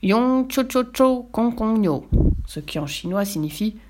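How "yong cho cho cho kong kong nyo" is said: high, drawn-out nonsense Chinese chant; giant's mock Chinese. A narrator's reading. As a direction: stretch each syllable, high and sustained. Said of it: "le géant lui dit".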